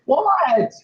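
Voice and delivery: high-pitched, startled "what"; incredulous. Real, not acted. Cat owner reacting to being told to get a dog, incredulous. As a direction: shoot up high in disbelief.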